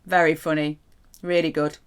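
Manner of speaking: dry sarcastic "very funny"; deadpan sarcasm, unimpressed; mock praise, sarcastic. Shown as a demonstration. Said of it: "if you say it sarcastically".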